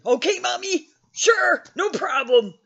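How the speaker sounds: put-on high, sugary obedient-kid voice; eager child. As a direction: chirp it like a kid desperate to please. Eager child obeying mom, acted.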